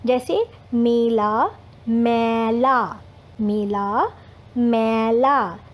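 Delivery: मेला with a closed e and मैला with an open ai, drawn out slowly; ए versus ऐ vowels. Shown as a demonstration. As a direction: contrast मेला and मैला, stretching each vowel. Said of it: "ये मेरे साथ बोलिए". (मेला और मैला साफ़ अलग बोलिए)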